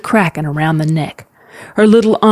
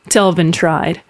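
ordinary delivery

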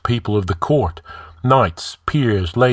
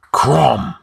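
loud, higher-pitched oath; swearing; 6 semitones higher, 5 dB louder than usual. A narrator's reading. King finding only the bare floor, swearing. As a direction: burst out with a startled oath. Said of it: "he swore".

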